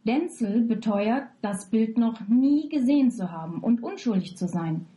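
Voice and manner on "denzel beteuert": ordinary talk, nothing marked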